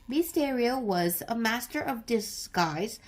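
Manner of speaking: plain reading voice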